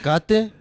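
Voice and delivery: playful Spanish "aguacate" as a question; mock Spanish word guess; joking Spanish aguacate. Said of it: "That's the aguacate".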